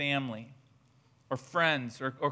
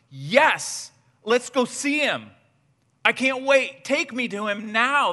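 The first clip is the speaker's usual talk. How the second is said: louder, higher, can't-wait eagerness; eager excitement; 5 semitones higher, 6 dB louder than usual. Pretend. Friend answering Philip with loud, eager excitement. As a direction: burst out like you can't wait to go.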